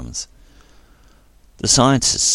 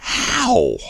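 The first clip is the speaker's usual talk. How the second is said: sharply high, stunned question; amazed at the impossible; astonished; 4 dB louder than usual. A narrator's reading. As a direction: disbelief breaking through. Dax stunned at friend's return.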